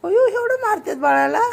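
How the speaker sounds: "अयो" pitched high and loud in playful shock; mock shocked. Real, not acted. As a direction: gasp it out, playfully scandalised. Child hitting the doll.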